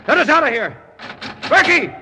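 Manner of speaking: very high, loud yells for help; desperate shout. Acted. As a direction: shout for rescue through the locked door. Trapped, pounding at the door.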